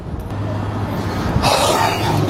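heavy despairing sigh. Acted. Broke dad stressing over medicine.

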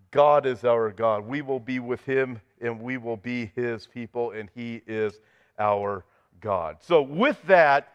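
usual speaking voice

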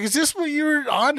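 high-pitched incredulous question; retold disbelief. Friend finds the metal grate, high-pitched disbelief. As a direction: imitate her voice rising in shock.

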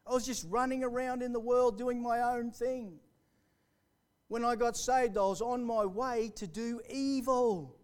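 high, loud testimony preaching; fervent preaching. Acted. Testimony preached at full force.

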